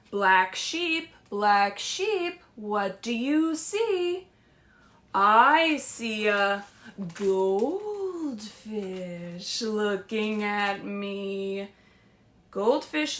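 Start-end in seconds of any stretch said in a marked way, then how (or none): 0.1-11.7 slow, singsong picture book read; lilting read-aloud to little kids; reading to children. Acted. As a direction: read it like story time.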